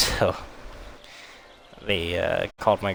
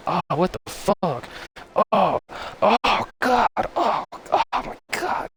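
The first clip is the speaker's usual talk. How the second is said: high-pitched hammed-up cries of pain; swearing and yelling; 12 semitones higher, 4 dB louder, slower than usual. Patient waking after surgery as hand pain hits, mock yelling. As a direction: yelp curses as the pain hits. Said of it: "I'm swearing and yelling".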